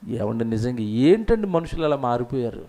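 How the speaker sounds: ordinary talk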